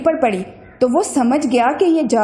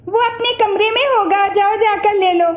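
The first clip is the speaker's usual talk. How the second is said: put-on much higher girl's voice; 7 semitones higher than usual. A narrator's reading. Bina tells the cousin where Saif is, girlish voice. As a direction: answer like a little girl.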